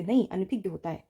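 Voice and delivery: normal talking voice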